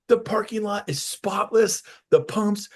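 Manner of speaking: the owner listing it in wonder; amazed boss. Retold or imitated.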